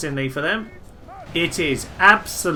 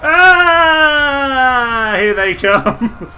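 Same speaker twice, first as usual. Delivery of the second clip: high, loud alarmed yelp, then slow; alarmed cry; 9 semitones higher, 11 dB louder, much slower than usual. Real, not acted. Gamer yelping as elephants crash into his troops, loud.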